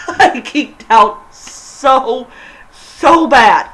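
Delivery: slow, laughing through the words. Real, not acted. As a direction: giggle it out, can't hold it in. Laughing while gushing.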